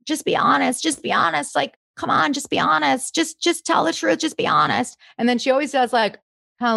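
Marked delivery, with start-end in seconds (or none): 0.1-5.0 lightly voiced impersonation, a bit higher and pleading; imitating a housewife. Impressionist mimics a housewife's pleading catchphrase. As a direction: mimic her urging someone to come clean. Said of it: "one of the things that she would say a lot was like".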